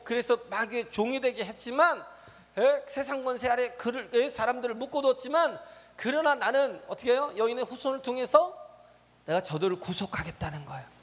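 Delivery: normal talking voice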